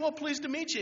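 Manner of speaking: bright, high welcome from an eager nerd; cheery high greeting. Retold or imitated. Dave greeting the new Indian student, cheery and high. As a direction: pitch it up like a friendly kid. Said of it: "He said".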